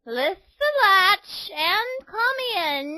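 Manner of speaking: high, slow sweet fake granny voice; wolf as granny. A narrator's reading.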